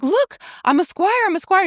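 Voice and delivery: put-on high, eager boy showing off; proud boy. Wirt boasting to Merlin and Archimedes, high and eager. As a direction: chirp it up like a kid proud of his new title.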